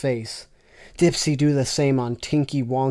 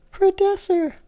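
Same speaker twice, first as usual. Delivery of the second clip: loud falsetto moan; 5 dB louder than usual. Acted. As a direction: call him out in a moaning falsetto. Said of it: "moaned loudly Lala".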